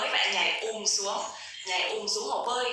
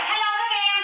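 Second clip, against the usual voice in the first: high, loud "Hello các em" as a muffled voice; muffled voice demo; 9 semitones higher, 4 dB louder than usual. Shown as a demonstration. Teacher demos a muffled sound to the class, high. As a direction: squeeze the voice like a voice changer. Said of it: "muffled sound nó sẽ là".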